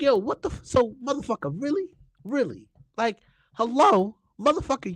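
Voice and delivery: high-pitched mock disbelief; incredulous retort. Acted outraged retort to leader.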